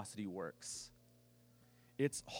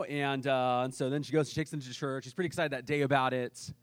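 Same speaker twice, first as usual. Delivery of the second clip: ordinary talk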